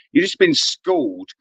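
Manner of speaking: usual speaking voice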